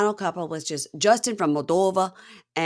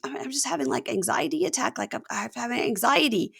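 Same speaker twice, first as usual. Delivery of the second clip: higher, softer flustered stammer; imitated anxiety; 6 semitones higher, 3 dB quieter than usual. Crying bride explains herself to groom, imitated fluster. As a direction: act out a small, panicky excuse.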